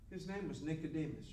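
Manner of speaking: usual speaking voice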